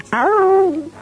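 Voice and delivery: high, loud sustained "ow"; squeaky robot howl. Acted. Robot ends its warning report, loud squeaky howl. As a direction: let out a piercing robot wail. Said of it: "little squeaky voice".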